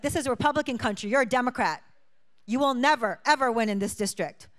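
loud, fast retold rant at the door; angry man shouting. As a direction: bark it out like a furious homeowner. Hostile homeowner tells her off.

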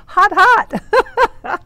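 high, loud squeals of "hot"; giddy exclaiming. Real, not acted. Gushing over Paul Walker, giddy and loud. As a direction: burst out high and loud, tickled.

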